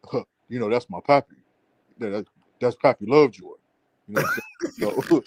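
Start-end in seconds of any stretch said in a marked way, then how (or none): 0.1-3.5 imitating him. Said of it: "I can't even do his voice".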